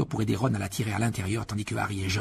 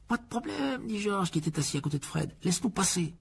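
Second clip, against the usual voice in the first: lightly voiced George, higher pitch; a hint of a higher character voice; George's light character voice; 9 semitones higher than usual. George's dialogue voice in story.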